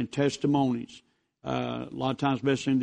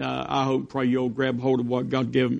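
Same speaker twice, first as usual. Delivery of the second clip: ordinary talk, nothing marked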